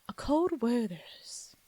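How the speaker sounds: slow, savoured mock British tagline; stretched British-Southern ad flourish; British-Southern accent. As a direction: draw out the brand in a British lilt. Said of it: "Southern British accent".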